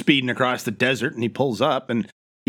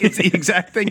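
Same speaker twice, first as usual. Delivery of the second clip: normal talking voice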